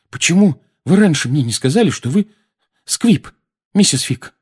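halting, strained question; out of breath. A narrator's reading. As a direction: ask as if short of breath from strain. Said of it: "задыхаясь от напряжения".

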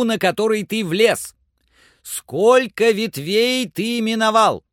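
high, loud call up to someone far above; commanding Legrand. A narrator's reading. Master directs his climber in the tree, commanding call.

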